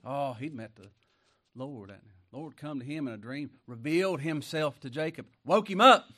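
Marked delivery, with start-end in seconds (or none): 5.4-6.1 a sudden high, loud shout on up. Real, not acted. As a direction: burst out loud like a wake-up call. Preacher shouts the Lord woke Jacob.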